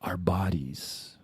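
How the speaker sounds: slow dramatic whisper; hushed reveal, slow. Real, not acted. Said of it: "dramatic whisper".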